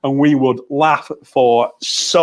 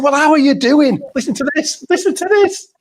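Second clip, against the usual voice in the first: excited greeting; 12 semitones higher than usual. Retold or imitated. Retelling his eager greeting.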